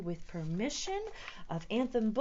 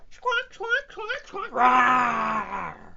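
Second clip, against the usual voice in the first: bird squawk and roar; 3 semitones higher, 11 dB louder, slower than usual. Acted. Reader voicing the bird then Yeti's roar.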